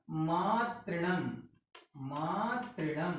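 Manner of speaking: मातृणम drawn out slowly and softly, twice; slow soft demo. Shown as a demonstration. Teacher to students, softly repeating मातृणम slowly. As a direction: linger over मातृणम so the class hears each syllable. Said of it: "इस तरीके से".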